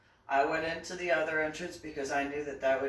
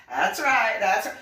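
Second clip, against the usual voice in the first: laughing boast; 5 semitones higher, 9 dB louder than usual. Real, not acted.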